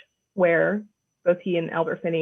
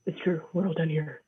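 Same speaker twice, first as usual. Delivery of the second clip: mock low American-man voice, softer; lowered, understated macho parody; 3 semitones lower, 7 dB quieter than usual. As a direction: drop low and quiet like an actor faking American. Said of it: "every man just talks like this".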